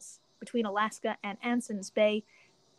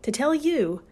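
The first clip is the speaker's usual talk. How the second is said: upbeat, loud sponsor read; 6 dB louder than usual. Acted. Host reading a Spotify ad to listeners, upbeat ad read. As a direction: sell the app with a bright pitch.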